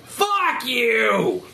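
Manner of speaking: high, strained goblin yell; high-pitched mock goblin shout; goblin heckler. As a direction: screech it from across the bar. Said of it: "From some goblin across the".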